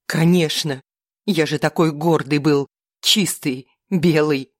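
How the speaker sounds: bitter, spat-out words; spitting contempt. A narrator's reading. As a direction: sneer at each word. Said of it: "Габриэль выплёвывал каждое слово с презрением". (с презрением, выплёвывая слова)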